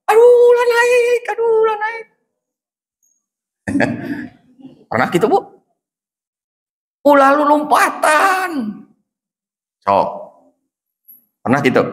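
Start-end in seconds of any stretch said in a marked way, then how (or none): 0.0-2.0 put-on high panicky cry to a climbing child; panicked parent. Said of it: "yang terucap pasti". 7.1-8.5 put-on high, slow cry to a child; alarmed parent.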